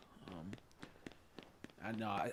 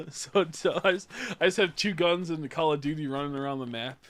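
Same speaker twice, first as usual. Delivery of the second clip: laughing disbelief; 5 semitones higher, 10 dB louder than usual. Real, not acted. Laughing at his own clip.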